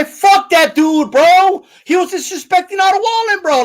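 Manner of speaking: loud, high, indignant outburst; heated rebuttal. Real, not acted. Streamer rejecting the nice-guy defence, real heated outburst. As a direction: throw it back hard, stretching the first words.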